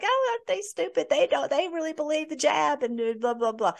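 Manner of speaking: high, sneering mockery of the believers; a high, taunting imitation of scoffers; mocking sneer. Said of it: "where they laugh at us behind their backs".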